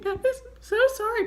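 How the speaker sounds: high, tight voice fighting tears; holding back tears. Retold or imitated. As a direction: apologise while trying not to cry. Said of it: "trying really hard not to cry".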